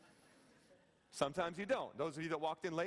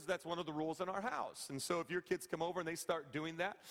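ordinary delivery

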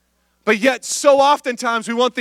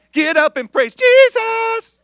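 much higher, hyped call to praise; worship band hype; 13 semitones higher, 4 dB louder, slower than usual. Pretend. Pastor imitates the band rousing the crowd, high. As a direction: call the room up like a worship leader. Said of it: "You guys didn't know I had range like that".

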